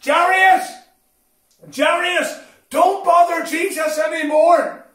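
loud, high shout to get attention; shouted call. Retold or imitated. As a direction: call out urgently across a crowd, acting the messenger. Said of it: "Then somebody shouted".

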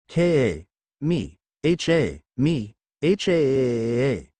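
slow, drawn-out attack cry; attack yell. Acted. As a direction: stretch out the Kamehameha chant. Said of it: "yelled Goku as he sends his".

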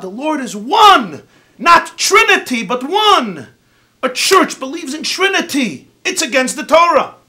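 fervent proclamation. Retold or imitated. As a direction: preach it at full force like a believer. Rebbetzin recites and preaches at him.

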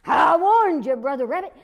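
high, loud warning; loud high threat; angry threat. Acted. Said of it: "he said".

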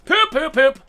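high, loud rapid-fire yelps; exclaimed dismay. Real, not acted. Gamer getting killed in game, loud dismayed yelps.

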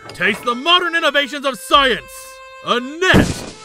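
loud, high grand proclamation; triumphant battle cry. Acted. Catcher launching a net, triumphant shouting. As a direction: declaim it as you attack.

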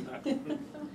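a high, quiet laugh breaking through; a short giggle at the joke; brief laugh. Real, not acted. Reader laughing at the graffiti line.

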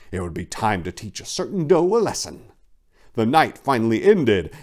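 ordinary delivery

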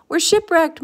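high, loud storybook lament; mock moan of dismay. A narrator's reading. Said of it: "moaned the elephant".

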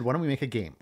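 normal talking voice

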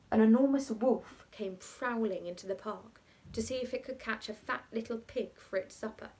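ordinary delivery

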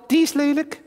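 high puppet voice; talking doll. Acted. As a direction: doll voice, disgusted. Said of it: "Henk is mijn pratende pop".

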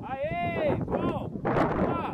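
excited cheer. Real, not acted. Cheering rider from across arena.

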